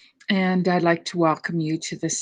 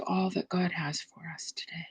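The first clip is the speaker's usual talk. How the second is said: whispered; 10 dB quieter than usual. Acted. Meditation, inviting openness to God.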